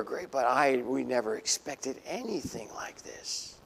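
fading into a hushed whisper; stunned disciples whispering; awed whisper. Retold or imitated. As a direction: marvel under your breath. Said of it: "And these guys".

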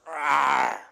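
mock wolf growl roared out loud. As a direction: roar like a cartoon wolf. Preacher voices wolf in parable.

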